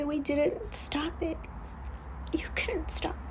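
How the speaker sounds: soft consoling whisper; a hushed whisper of forgiveness. Real, not acted. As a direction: breathe it gently to comfort them. Consoling viewers after tears.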